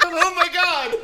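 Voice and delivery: high squeaky mock horror through laughter; mock shock. Host mimicking Zoe seeing a child as Kyle, mock shocked.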